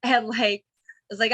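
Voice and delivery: ordinary talk, nothing marked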